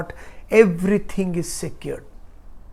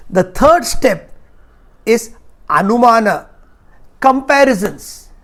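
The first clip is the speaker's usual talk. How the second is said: forceful preaching; 4 semitones higher, 10 dB louder than usual. Acted. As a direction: drive each point home to the hall. Teaching at full force.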